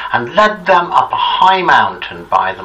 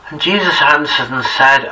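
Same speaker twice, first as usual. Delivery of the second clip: ordinary delivery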